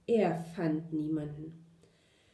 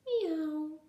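high, drawn-out kitty meow; cat meow; 8 semitones higher than usual. A narrator's reading. Someone unseen after the bee left, high cat meow.

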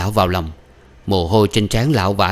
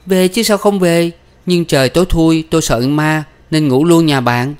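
high, pert daughter's voice; loud, high, defiant excuse; cocky retort; 8 semitones higher, 5 dB louder than usual. Acted. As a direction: answer back with brazen cheek. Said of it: "Mặt Đào vênh lên".

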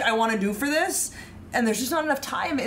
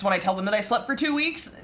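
usual speaking voice

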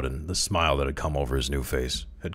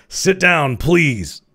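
loud, high forceful order; shouted command; 10 semitones higher, 8 dB louder, much slower than usual. A narrator's reading. Crowley ordering the alarmed Cowden, shouted. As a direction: shout it across at him. Misread as shout, then corrected.

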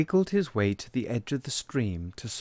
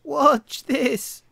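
much higher, louder lamb voice; the lamb boasts to Tadpole, much higher and louder; 9 dB louder than usual. Acted. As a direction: brag it in a bright lamb squeak. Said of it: "said Lamb".

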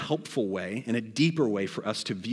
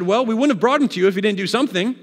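quick, higher, louder mock-indignant comeback; indignant retort; 8 semitones higher, 7 dB louder, faster than usual. Leaders dodging Pilate's question, indignant. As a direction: brush off the question with a rushed, pitched-up reply.

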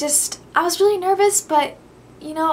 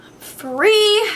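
excited; 9 semitones higher, 9 dB louder than usual. Real, not acted. Headband off, joyful.

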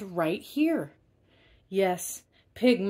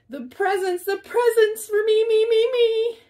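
high squealing greedy voice, louder; very high gleeful piggy squeal; 7 dB louder than usual. Acted. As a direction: squeal it like a spoiled pet grabbing gifts. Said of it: "He'd giggle with glee".

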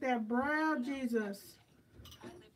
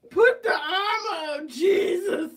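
loud, high hammed-up sermon cry; mock preacher shout; 3 semitones higher, 10 dB louder than usual. Vlogger hamming up a prayer over her brows.

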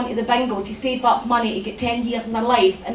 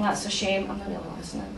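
much softer, lower bored mumble; 5 semitones lower, 11 dB quieter, faster than usual. Retold or imitated. Mum brushing off her daughter in a soft, low mumble. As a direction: mutter it, not really listening. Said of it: "And I'm like".

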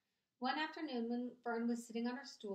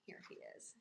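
ordinary delivery, nothing marked

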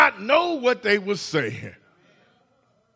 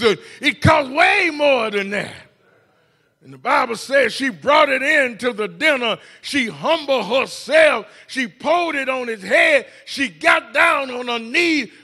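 rising, loud preacher cadence; the pastor preaches to the congregation, building; preaching at full force; 5 semitones higher, 3 dB louder than usual. Acted.

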